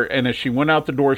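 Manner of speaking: plain reading voice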